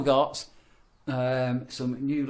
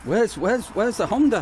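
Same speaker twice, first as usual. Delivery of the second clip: high, loud, stammering panic; panicking that the car was stolen; mock panic; 9 semitones higher, 4 dB louder than usual. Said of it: "I'm thinking".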